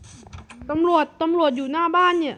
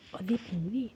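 much softer, lower aside; hushed plotting; 6 semitones lower, 12 dB quieter than usual. Acted. Robber quietly suggests the next move to his partner. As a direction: keep it down, scheming.

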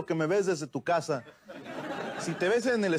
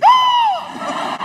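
a huge shrieking gasp, far higher and louder; shriek-gasp in panic; inner horrified gasp; 11 dB louder than usual. Retold or imitated. His own inner horror at what he did, a huge shrieking gasp. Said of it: "y yo por dentro".

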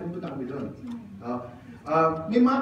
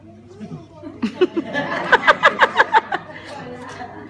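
high laugh; 12 semitones higher, 5 dB louder than usual. Real, not acted. Laughs at his own contrast.

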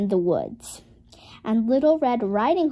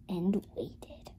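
whisper; 3 semitones lower, 11 dB quieter than usual. A narrator's reading. Suspense in story.

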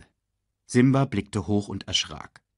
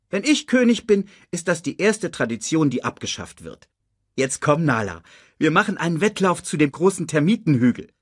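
higher, lively lion cub voice; defiant young Simba; 8 semitones higher, 4 dB louder than usual. Acted. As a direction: declare it boldly, then hurry into the dare. Cub Simba declares his plans.

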